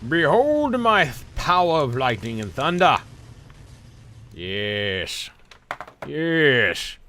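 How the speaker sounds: slow, loud villain gloat; megalomaniac rant. Acted. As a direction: proclaim victory like a tyrant. Hams up villain victory.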